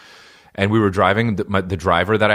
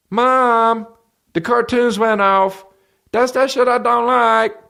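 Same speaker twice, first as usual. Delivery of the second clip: put-on very high, drawn-out kid voice; whiny little kid; slower than usual. Little kid complaining to mom, high-pitched whine. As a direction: squeal it like a small child complaining.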